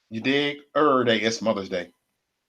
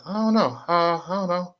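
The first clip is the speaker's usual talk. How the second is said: higher-pitched feigned cluelessness; playing dumb; 4 semitones higher, 5 dB quieter than usual. Troll telling how he fooled a man, playing dumb. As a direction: act innocently puzzled, a little high. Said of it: "I play it like I don't know what it mean".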